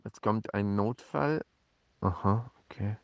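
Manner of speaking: deutlich leiser und tiefer, wie zu sich selbst; leise Zwischenbemerkung. Real, not acted. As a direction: sprich es leise beiseite, abgelenkt. Unterbricht sich für einen eintreffenden Notfall. (leise beiseite gesprochen)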